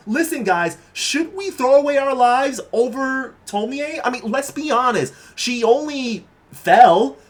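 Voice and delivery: a hint of a bossy classmate rallying everyone; persuasive schoolgirl. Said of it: "this one girl out here just talking to the whole mass".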